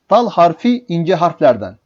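ordinary talk

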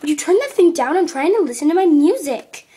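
high, loud yell over the music; angry yelling. Acted. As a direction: shout at your sister to turn it down. Sisters fight over loud music.

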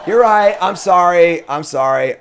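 loud laughing you're right; sheepish laughing sorry; laughing apology. Real, not acted.

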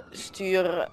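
ordinary talk

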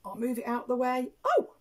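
ordinary delivery

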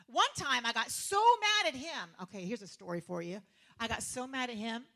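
ordinary delivery

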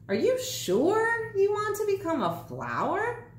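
high, squeaky little gnome asking; a high, doubtful magic gnome. A narrator's reading. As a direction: lift it way up into a tiny gnome's question. Gnome's question.

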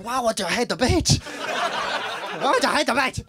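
put-on high, laughing Spanish accent; confused Spanish clubber, slow. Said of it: "That's my Spanish accent".

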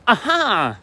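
high gotcha exclamation; triumphant aha. Acted. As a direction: pounce on the slip. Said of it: "la señora vieja hizo".

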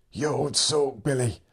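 put-on an old farmer's cracked, teasing voice; old man teasing. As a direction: rib him like a wheezy old friend. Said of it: "He'd laugh that cracked laugh of his".

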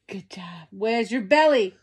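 normal talking voice

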